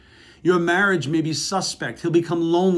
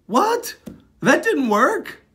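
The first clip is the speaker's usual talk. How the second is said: much higher, louder stunned what; disbelieving, caught off guard; baffled shock; 11 semitones higher, 6 dB louder, much slower than usual. Retold or imitated. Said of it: "I'm like".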